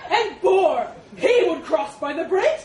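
loud, high booming challenge; accusing elephant. Acted. Elephant pressing the mouse about boar, loud and accusing. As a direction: bellow the next accusation.

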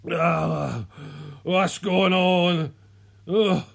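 slow, groggy just-woken voice; drawn-out, sleep-fogged mumbling; bleary. A narrator's reading. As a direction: groan it out half-asleep. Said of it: "he said blearily".

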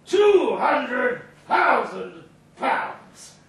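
drawn-out shout, every word stretched slow; slow boastful shout. Acted. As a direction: spell out the fortune to the crowd. Boasting drunk shouting his salary.

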